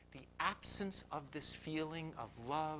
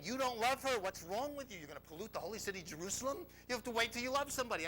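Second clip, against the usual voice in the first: higher, agitated self-reproach; scolding inner voice; 7 semitones higher, faster than usual. Retold or imitated. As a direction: tell yourself off as your own nagging mind. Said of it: "my mind is saying".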